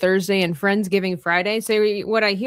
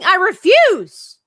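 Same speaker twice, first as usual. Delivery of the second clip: loud, high, drawn-out refusal; mock defiant yell; 11 semitones higher, 11 dB louder, much slower than usual. Answering talk of dying, mock defiant yell. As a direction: belt it out in playful protest.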